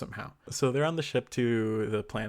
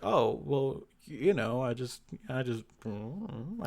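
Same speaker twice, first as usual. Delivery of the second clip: hemming and hawing; 3 semitones higher, slower than usual. Acted. Poe dodging her question, hemming and hawing. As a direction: stumble over false starts and trail into a hum. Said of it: "he's kinda, like, hemming and hawing".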